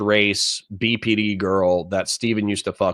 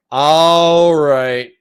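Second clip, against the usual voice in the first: louder, drawn-out call to start; rally the chat; loud all right; 6 semitones higher, 8 dB louder than usual. Real, not acted. Streamer rallying chat to start, loud drawn-out call.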